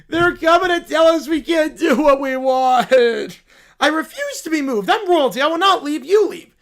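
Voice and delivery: put-on defiant bratty kid. Spoiled kid refusing security guards, defiant whine. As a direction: dig in like a tiny monarch.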